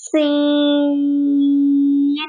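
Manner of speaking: سین with a hissing s held long; long recited سین; whistled seen. Shown as a demonstration. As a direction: let the s in سین whistle, then stretch it. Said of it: "سین میں بھی سیٹی کی آواز آئے گی".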